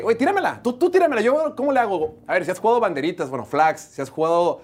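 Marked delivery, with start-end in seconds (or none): 0.0-2.2 high, pushy plea for the ball; demanding receiver. Retold or imitated. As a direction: whine like a receiver wanting the ball.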